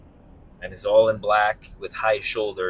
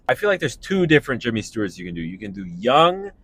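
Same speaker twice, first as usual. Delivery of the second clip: usual speaking voice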